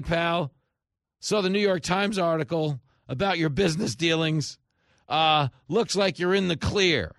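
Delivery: high and loud, building outrage; outraged. Real, not acted. Host to listeners about Biden's voicemail, outraged and loud. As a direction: hammer it home, incredulous.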